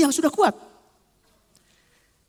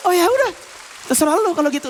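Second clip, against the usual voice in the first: high-pitched, huffy dismissal; sulky giving up; 4 semitones higher than usual. Acted. Rebuffed tempter sulking at the soul, high and huffy. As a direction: throw it back in a mock sulk. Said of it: "Dia ngambek tuh".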